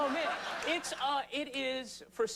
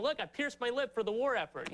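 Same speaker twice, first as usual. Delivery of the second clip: mock college kid; 7 semitones higher than usual. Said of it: "piercing their lip and going".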